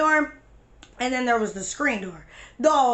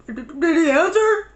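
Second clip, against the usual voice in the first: acted tearful; 4 semitones higher, 4 dB louder than usual. Acting herself trying not to cry.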